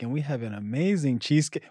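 lightly acted server presenting a dessert; waiter. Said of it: "The waiter's like".

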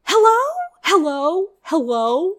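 loud high hello, fading; calling out. Real, not acted. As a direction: call out as if checking the line. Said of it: "calling out".

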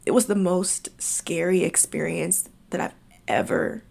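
soft, whispered admission. Real, not acted. Telling viewers her scariest experience, in a whisper. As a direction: let it fall into a whisper. Recalling her panic attack.

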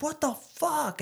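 high-pitched mock outrage; retold outrage. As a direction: imitate a driver squealing in disbelief. Driver's outraged reaction, retold.

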